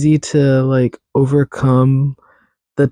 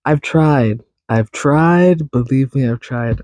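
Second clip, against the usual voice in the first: normal talking voice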